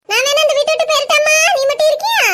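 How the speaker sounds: guilt-trip her with exaggerated hurt; mother-in-law offers to leave to guilt the daughter-in-law; hammed-up martyr.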